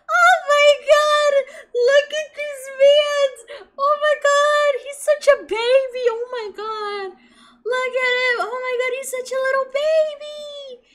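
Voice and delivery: high, loud squeal over a cute character; squealing excitement. Real, not acted. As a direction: shriek with delight, laughing.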